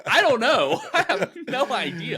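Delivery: high, loud, laughing helplessness; hammed-up clueless parent outburst; exasperated parents. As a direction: throw up a mock-desperate cry, laughing. Exasperated parents answering screaming child.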